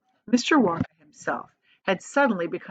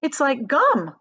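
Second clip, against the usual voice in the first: higher, louder know-it-all cry; cried out; 6 semitones higher, 4 dB louder, slower than usual. A narrator's reading. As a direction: blurt it out eagerly. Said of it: "cried Violet Beauregard".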